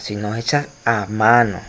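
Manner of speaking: ordinary talk, nothing marked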